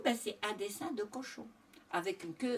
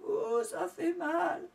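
storybook sobbing; 10 semitones higher than usual. A narrator's reading. Doudou to his brother as his tail hurts, mock sobbing. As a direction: play a piglet wailing in pain. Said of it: "il éclata en sanglots".

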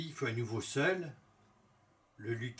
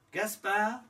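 higher, hopeful guess; queen's voice; 8 semitones higher, 4 dB louder than usual. A narrator's reading. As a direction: ask the name hopefully, higher. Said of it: "son énumération".

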